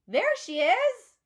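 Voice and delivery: loud, high, slow shout of discovery; excited call. A narrator's reading. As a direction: call out across the classroom. Classmate calls out on arrival.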